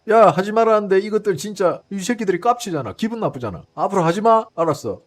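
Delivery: loud, exasperated telling-off; hammed-up indignant reprimand; mock angry scolding. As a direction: act out warning a troublemaker. Acting out America scolding Israel again.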